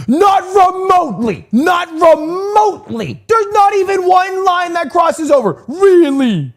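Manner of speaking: high, slow shouted outburst; exasperated shout. Real, not acted. Streamer loses it at chat over the Silk Road map, shouting high and slow. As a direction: yell it at the dolts in chat, drawn out. Exasperated at chat's dumb question.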